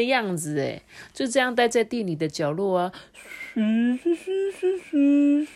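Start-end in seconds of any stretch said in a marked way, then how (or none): 3.5-5.5 carefree whistle sounds; whistling. A narrator's reading. Idle pot whistling all day in the corner. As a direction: whistle idly. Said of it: "还在那边吹口哨".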